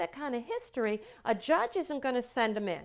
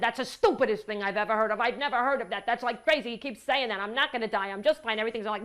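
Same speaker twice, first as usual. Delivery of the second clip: fast, loud imitation of an angry father; 5 dB louder, faster than usual. Father dismissing his son's fear that he will die, mock angry. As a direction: act out an indignant dad's rapid outburst. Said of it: "The father's so angry now".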